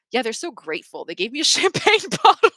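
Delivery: giggling in disbelief at the free champagne; laughing. Real, not acted. Laughing as she tells listeners about the champagne bottle. Laughs through the gift story.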